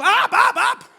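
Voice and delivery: confused visitor shouting the men's word back, loud; panicked echo. Retold or imitated. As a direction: blurt it back in a confused yell. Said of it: "Y yo también le digo".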